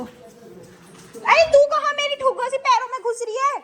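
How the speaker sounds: high sing-song pet talk. Real, not acted. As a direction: coo at the little one at your feet. Talking to pet at feet.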